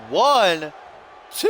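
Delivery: high, loud count that cracks. Real, not acted. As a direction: let the voice break on the count. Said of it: "that voice crack".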